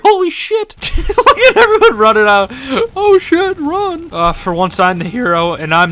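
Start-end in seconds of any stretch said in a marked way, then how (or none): 0.0-4.1 high, loud laughing disbelief. Real, not acted. A gamer cracking up at the burning library, laughing loudly. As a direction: crack up at the chaos.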